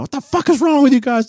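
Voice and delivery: high, outraged retelling; imitated tripping out. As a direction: mimic an indignant outburst, pitch way up. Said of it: "He starts tripping out".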